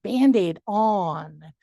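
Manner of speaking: put-on slow, clear words for a child; slow, deliberate model of the phrase; slow modelling. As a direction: spell it out slowly for her.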